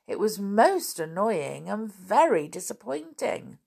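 ordinary delivery, nothing marked

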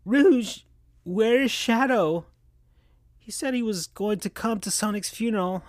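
put-on high, sad, toothless girl voice; lisping sad girl without teeth; Amy's voice. Amy asks Rouge where Shadow is, high and sad. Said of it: "Amy sounded weird and sad".

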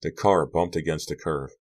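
usual speaking voice

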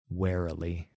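ordinary delivery

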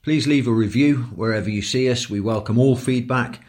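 steady announcer read; podcast outro. Acted.

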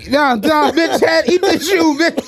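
high giggly rant; laughing through the words. Real, not acted. Laughing outburst.

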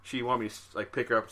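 ordinary talk, nothing marked

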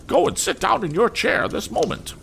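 very high, imperious scolding lady; haughty mother scolding. A narrator's reading. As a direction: look down on the child and scold her sharply. Mrs Pocket voiced in story.

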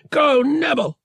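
high, loud shout of go; loud cry. A narrator's reading. Han urging Nebel through the gap, crying out. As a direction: urge them on with a cry. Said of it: "cried Han".

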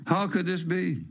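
usual speaking voice

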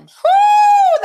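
very high, loud whoop; excited whoo. Real, not acted. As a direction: cheer it out. Excited about [name] vs [name].

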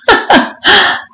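short high-pitched giggle; amused laugh. Acted. Mistress amused by her own test, high laugh. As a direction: let out a brief, high chuckle.

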